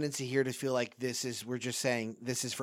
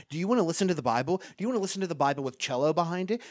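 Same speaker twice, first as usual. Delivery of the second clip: fast, rising, piling up options with higher, louder peaks; excited rapid list; 6 semitones higher, 8 dB louder, much faster than usual. Real, not acted. Pastor to listeners, mocking the endless Bible options, rapid and excited. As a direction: rattle them off faster and faster like an excited salesman.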